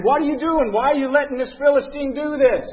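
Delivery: David calling out to the fearful soldiers, indignant shout. Retold or imitated. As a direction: call it out to the troops, indignant. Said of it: "David's shouting to the men".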